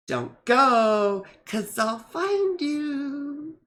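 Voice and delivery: high, slow, menacing-sweet movie line; Anna Kendrick quote. Retold or imitated. Quoting Anna Kendrick's Wedding Crashers line, slow playful menace. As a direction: stretch it out like a cute threat. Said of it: "she's like".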